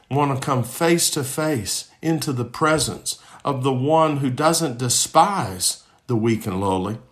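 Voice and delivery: ordinary talk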